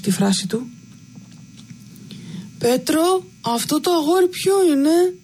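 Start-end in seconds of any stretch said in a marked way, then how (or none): 2.6-5.1 put-on higher, odd childlike voice; strange childlike voice. Simple-minded brother asks Petros about the boy, in a higher childlike voice. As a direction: ask it like an innocent child lost in the moment. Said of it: "Φωνή παράξενη, με προφορά σχεδόν νηπιακή".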